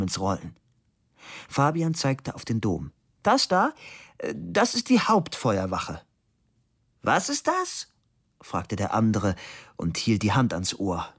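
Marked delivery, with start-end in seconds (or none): 3.2-6.0 pointed, high-pitched opening; matter-of-fact answer, lifted at the start; raised voice answer. A narrator's reading. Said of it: "Er stellte sich auch noch schwerhörig". 7.0-7.9 high-pitched puzzled question; feigning hard of hearing. A narrator's reading. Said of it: "Er stellte sich auch noch schwerhörig".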